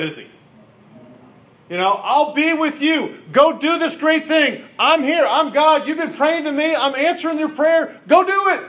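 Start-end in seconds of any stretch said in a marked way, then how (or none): 2.0-3.2 higher, slow, reassuring; God gently pressing a doubting man. Acted. Preacher voices God reassuring Gideon. 3.3-8.6 higher, pressing encouragement; God urging Gideon to act. Acted. Preacher voices God urging Gideon.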